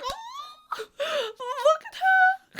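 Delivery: very high, slow, squeaky coo; aww coo. Retold or imitated. Fan watching nervous Adina, very high squeaky aww. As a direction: gush over her like a puppy. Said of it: "I was like".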